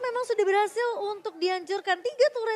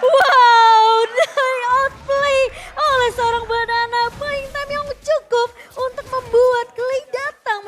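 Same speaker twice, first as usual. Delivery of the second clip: high, loud, drawn-out excited scream; screaming wow; 6 semitones higher, 10 dB louder, slower than usual. Real, not acted. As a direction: lose it at the outplay. Co-caster screams at outplay.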